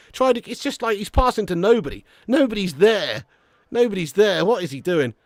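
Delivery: usual speaking voice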